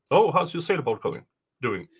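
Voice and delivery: ordinary talk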